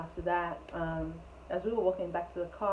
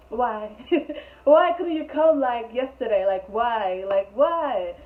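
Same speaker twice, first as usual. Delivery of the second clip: much louder, higher frustrated why; exasperated outburst; 4 semitones higher, 9 dB louder, slower than usual. Real, not acted. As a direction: throw up your hands and demand why. Venting at the ex-girlfriend's timing.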